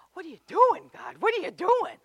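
high, loud cry of confusion; loud bewildered call to God; exasperated plea. Retold or imitated. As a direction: demand answers from God. Imitating a puzzled believer.

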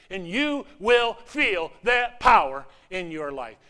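loud, slow shouted promise; slow, loud pulpit shout; preaching shout. Real, not acted. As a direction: proclaim it at full voice.

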